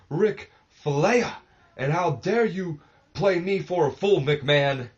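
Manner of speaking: put-on high, loud, blustering old wrestler; hammed-up Nature Boy bragging at full volume; Ric Flair voice. Egotistical wrestler yelling back at the owner who called him out. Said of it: "I hate my Ric Flair accent".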